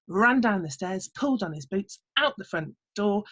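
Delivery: ordinary delivery, nothing marked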